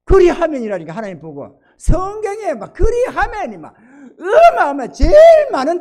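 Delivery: loud, high, emphatic pulpit voice; impassioned preacher at full force; forceful preaching. Acted. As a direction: drive the key word home to the congregation.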